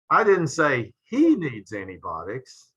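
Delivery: slow, with a rise on he, someone else needs them; stress on he. Shown as a demonstration. Lecturer showing how stress shifts meaning, slow stress on he. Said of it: "notice how the meaning changes depending upon the inflection".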